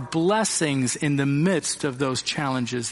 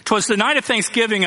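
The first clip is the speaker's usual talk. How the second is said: higher, louder dramatic recital opening; poem recital; 7 semitones higher, 4 dB louder, faster than usual. Shown as a demonstration. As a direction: launch the poem with theatrical flair. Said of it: "You ready?".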